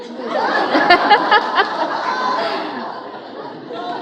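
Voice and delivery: high, loud burst of laughter. Real, not acted. Honoree bursts out laughing at her own grades.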